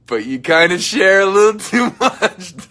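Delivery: laughing through a playful jab; giggling teasing scold; laughing tease. Real, not acted. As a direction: tease them while laughing.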